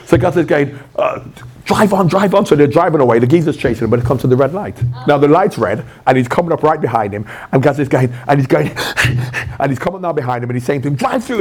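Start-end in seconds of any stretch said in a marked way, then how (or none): 1.6-2.5 urgent. Retold or imitated. Gazza urging Jimmy to flee. 11.0-11.4 high, urgent mock panic; panicked high plea. Gazza panicking at red light.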